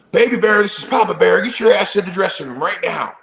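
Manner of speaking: dad summoning his son over the radio; playful radio call. Retold or imitated. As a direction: bark orders over the radio like Papa Bear.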